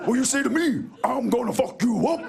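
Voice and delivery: high, doggy mock threat; menacing gang bro with a cartoon-dog voice; Scooby-Doo. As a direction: imitate a cartoon hound threatening a fight. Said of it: "bros who sound like Scooby-Doo".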